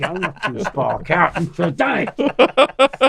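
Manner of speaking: high, slow, put-on mocking voice; mock a scared officer in a high voice; mocking whiny voice. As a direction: squeak it out to mock him.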